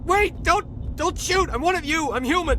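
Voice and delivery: high, loud frightened shouting; desperate high cries of a hiding survivor; panicked plea. Acted. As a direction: beg them not to shoot, terrified. Survivor begs soldiers not to shoot.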